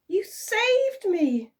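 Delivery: put-on high, slow, breathless young woman; girlish grateful sigh. A narrator's reading. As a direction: sigh it out in relief. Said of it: "sighed Isabel".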